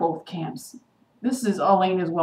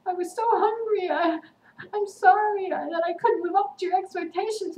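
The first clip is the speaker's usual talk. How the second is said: high-pitched, grovelling apology; mock pleading Elena; 12 semitones higher than usual. Vlogger mocking Elena begging Stefan's forgiveness, mock pleading. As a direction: plead pathetically in a put-on high voice. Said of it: "now she's turned into this".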